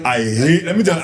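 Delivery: ordinary delivery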